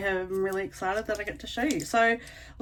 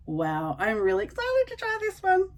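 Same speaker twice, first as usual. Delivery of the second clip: much higher, bubbly redo of the line; redone more excited; 12 semitones higher than usual. Shown as a demonstration. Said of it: "Oh, let me do that again".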